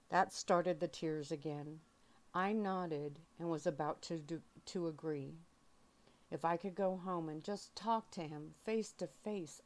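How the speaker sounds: ordinary delivery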